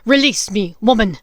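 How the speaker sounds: loud rough beast voice; a savage werewolf bellowing to be freed; werewolf's demand. Acted. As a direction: roar the order at her. Greyback commanding.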